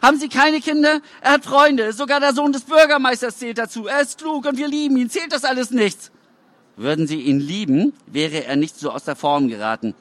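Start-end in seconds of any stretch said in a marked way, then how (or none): 0.0-6.1 high, desperate mother's voice; mother pleading with the officials for her son. A narrator's reading. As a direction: beg for her son's worth. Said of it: "Meine Mutter".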